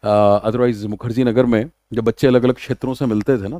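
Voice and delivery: normal talking voice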